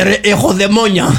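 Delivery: high-pitched, hammed-up plea for help; mock possessed complainer. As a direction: play a patient pestered by demons. Acts possessed person pleading for exorcism.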